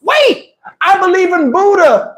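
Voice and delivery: high falsetto mock shout; hammed-up, high-pitched last appeal; desperate cry. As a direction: cry out high, imitating a woman pleading. Imitating woman's last desperate cry.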